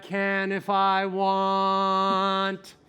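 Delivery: very slow, every vowel held on one tone; long connected monotone; sustained voice exercise. Shown as a demonstration. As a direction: intone it like a vocal warm-up. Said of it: "So say".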